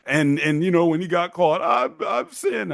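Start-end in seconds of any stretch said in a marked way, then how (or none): 1.6-2.7 high, very slow, drawn-out confession; preacher confession. Retold or imitated. Caught dad confessing his sin, high drawn-out wail. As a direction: moan it like a weeping televangelist. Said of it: "looking like Jimmy Swaggart".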